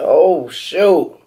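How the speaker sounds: normal talking voice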